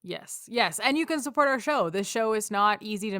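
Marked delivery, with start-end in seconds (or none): none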